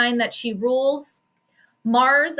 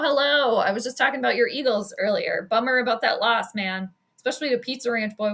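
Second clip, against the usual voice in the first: usual speaking voice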